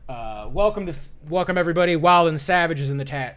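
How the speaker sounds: usual speaking voice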